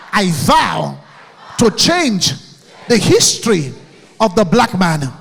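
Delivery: loud chant-like vow for the crowd to repeat; shouted affirmation. Shown as a demonstration. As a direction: lead the hall in a rallying vow.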